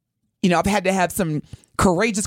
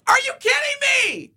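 real exasperated yell; incredulous shout. Real, not acted. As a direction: shout it in disbelief. Misgendered again by bartender.

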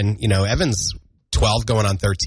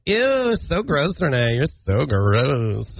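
mock disgust; much slower than usual. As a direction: drag out the disgust at your co-host. Mock disgust at co-host's joke.

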